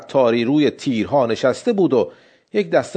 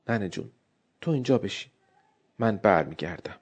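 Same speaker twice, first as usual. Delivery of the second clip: put-on soft, lower and hushed, tender to her little boy; tender to child; 6 semitones lower, 10 dB quieter, faster than usual. Mother quietly tells her drowsy son to wait, tender hush. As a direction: murmur it gently to the sleepy child.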